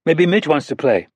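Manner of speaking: put-on high, earnest child voice; a young girl scolding a grown-up; little girl. Narrator voices young girl Anna.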